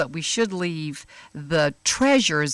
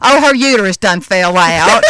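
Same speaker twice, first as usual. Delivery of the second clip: louder drawl with a laugh; Texas drawl; 10 dB louder than usual. Retold or imitated. Imitating the Texan driver telling the punchline, laughing. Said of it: "he talked like this".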